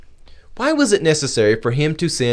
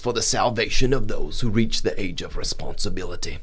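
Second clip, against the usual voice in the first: mock Southern accent; 4 semitones lower, 4 dB quieter than usual. Pastor jokingly reads the article as a Southern gentleman, mild Southern accent. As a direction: play a hammed-up old South Carolina gentleman, low and soft. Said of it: "a s-, thick Southern accent".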